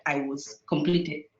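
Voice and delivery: ordinary talk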